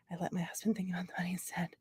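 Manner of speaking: breathy joking whisper, fast; quick conspiratorial whisper, fast; whispered aside. Real, not acted. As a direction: hush it out like a secret aside. Joking aside about husband and money.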